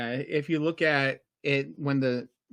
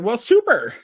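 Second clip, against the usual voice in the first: high, loud mock enthusiasm; hammed-up cheerful quote; mock cheery; 10 semitones higher, 10 dB louder than usual. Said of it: "And he said".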